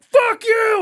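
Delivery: high, loud holler; yelled from passing bikes. Retold or imitated. Dirt bikers flying past a stranger in line, loud yell. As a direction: yell it as you blast past. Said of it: "they're flying past, and they're like".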